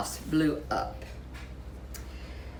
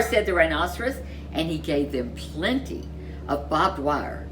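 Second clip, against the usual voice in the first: usual speaking voice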